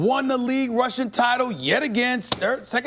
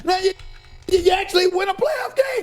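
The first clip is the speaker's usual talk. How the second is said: gloating shout. Real, not acted. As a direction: yell it like rubbing it in. Gloating rant at Cowboys.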